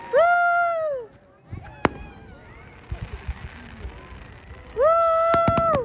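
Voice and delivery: long, high whoop; cheering woo. Real, not acted. Cheering the Canada Day fireworks, high whoop. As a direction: give a high, drawn-out woo.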